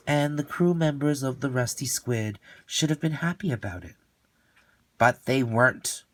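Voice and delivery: plain reading voice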